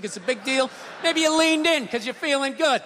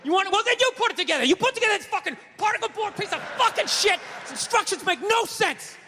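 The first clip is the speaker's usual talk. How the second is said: high, heated quarrel with a partner; acted argument; 4 semitones higher than usual. Man arguing with girlfriend over furniture, high heated voice.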